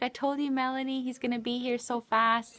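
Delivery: high, quick told-you-so; retold excitement. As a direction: re-enact telling a friend excitedly.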